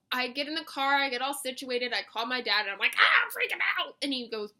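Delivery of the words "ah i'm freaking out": high, loud hammed-up freak-out; mock panic. Daughter calling dad from the car, hammed-up panic. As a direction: wail it in exaggerated panic.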